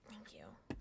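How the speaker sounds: soft breathy thanks; whispering. Real, not acted. Unvoiced low aside.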